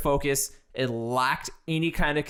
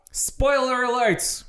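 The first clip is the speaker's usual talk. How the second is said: high, drawn-out warning call; spoiler announcement; 7 semitones higher, slower than usual. Real, not acted. Host warning listeners before diving into spoilers, drawn-out announcement. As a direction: stretch it out like an announcer's alert.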